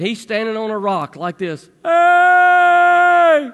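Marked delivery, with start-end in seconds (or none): 1.8-3.5 put-on loud call from a stranded boy; imitating a kid hollering hey; son's yell. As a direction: yell for help across the river. Son stranded on rock yelling.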